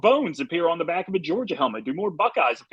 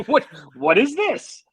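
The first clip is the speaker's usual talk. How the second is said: confused surprise; 9 semitones higher, 5 dB louder, slower than usual. Retold or imitated. Confused freshman reading chant.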